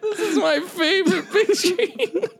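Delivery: high, teary, wheezing laughter; helpless laughter. Real, not acted. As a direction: laugh until you're crying. Can't stop laughing at face.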